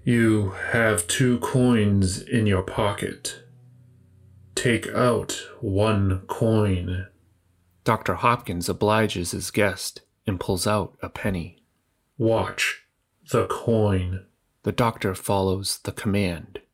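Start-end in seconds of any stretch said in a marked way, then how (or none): 0.0-7.1 slow, even robotic stranger. Acted. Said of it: "the robotic voice states". 12.2-14.2 slow, even robotic stranger. Acted. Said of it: "the robotic voice states".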